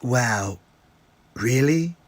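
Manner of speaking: normal talking voice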